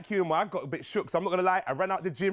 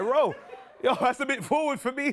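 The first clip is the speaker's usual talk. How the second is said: laughing, high, shocked; 8 semitones higher than usual. Retold or imitated. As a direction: laugh it out in disbelief.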